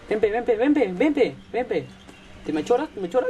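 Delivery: high, teasing come-here call to the monster; sing-song dare, half-joking; mock taunt. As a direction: goad it like a street bully.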